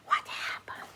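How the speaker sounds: hushed unvoiced whisper; storyteller's whispered question. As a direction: breathe it like a secret.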